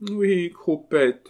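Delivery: high, slow, loud lament; doleful. Acted. Marquise confirming to the abbé, high and doleful. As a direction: draw it out mournfully. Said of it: "d'un ton dolent".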